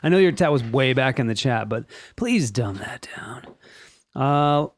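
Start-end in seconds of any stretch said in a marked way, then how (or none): 1.7-3.4 whisper. Real, not acted. Whispered aside to chat.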